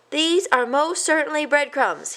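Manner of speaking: high, firm mouse voice; emphatic mouse. A narrator's reading. An annoyed cousin insists firmly, high. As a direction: insist on every word.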